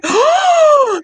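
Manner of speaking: high, drawn-out gasp; breathy high cry of astonishment; shocked delight. Retold or imitated. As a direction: act out gasping at a huge surprise. Acting out gasp at seeing ring.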